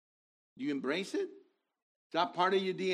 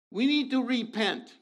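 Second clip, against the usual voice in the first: urgent call; 8 semitones higher, 6 dB louder than usual. Real, not acted. Preacher calling congregation to repent.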